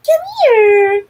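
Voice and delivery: high, loud call; sing-song call to a pet; calling the dog. Real, not acted. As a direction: call the dog over brightly.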